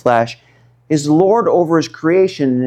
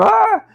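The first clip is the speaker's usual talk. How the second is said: mock scream. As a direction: shriek like frightened men in a boat. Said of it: "They're screaming".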